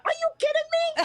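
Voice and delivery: shocked high squeal; disbelieving shriek; surprised. Retold or imitated. Retelling meeting the quarterback.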